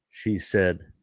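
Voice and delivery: ordinary talk, nothing marked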